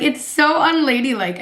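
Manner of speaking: normal talking voice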